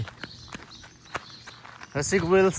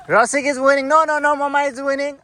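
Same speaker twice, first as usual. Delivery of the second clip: excited; 8 semitones higher, 5 dB louder than usual. Pretend. Race commentary playing with child.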